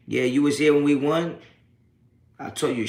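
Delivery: usual speaking voice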